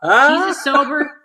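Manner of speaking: loud, high, talking through laughter; real laughter breaking into the words; laughing hard. Real, not acted. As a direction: push the words out while cracking up. Both cracking up over watermelon feat.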